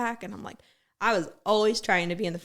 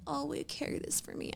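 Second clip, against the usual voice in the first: higher, softer, breathy-sounding mock beg; mocking whiny plea; 7 semitones higher, 7 dB quieter than usual. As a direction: mimic a helpless girl asking sweetly. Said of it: "they'd be like".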